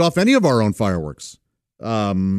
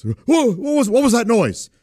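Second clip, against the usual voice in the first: mock terror; 9 semitones higher than usual. Uncle pretending to niece that fireworks are scary, high mock fright. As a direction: ham up being scared of a bang. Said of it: "I was pretending to be terrified of the fireworks".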